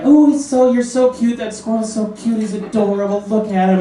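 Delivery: put-on high, gushing girly coo; lightly mocking girls cooing over a pet squirrel. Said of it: "these girls are like".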